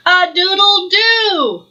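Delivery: high cock-a-doodle-doo; rooster crow. Acted. Duddle crows instead of quacking for the animals. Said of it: "he roared a mighty quack".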